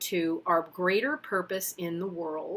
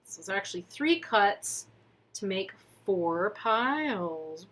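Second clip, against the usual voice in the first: ordinary talk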